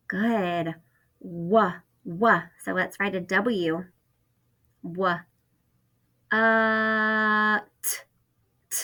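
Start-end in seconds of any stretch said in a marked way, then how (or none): 4.8-8.8 "Wha-, uh, t" broken into separate sounds; segmented sounds. Shown as a demonstration. Teacher splitting what into its sounds for first graders. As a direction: pull "what" apart sound by sound. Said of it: "Do you hear that T at the end".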